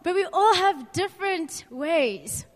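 usual speaking voice

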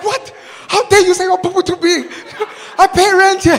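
loud, high, laughing indignation; mock outrage. Tenant protesting to the landlord, comically outraged. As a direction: protest at full volume, half laughing. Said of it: "I was so upset".

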